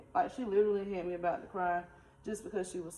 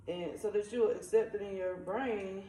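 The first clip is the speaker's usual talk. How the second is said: ordinary delivery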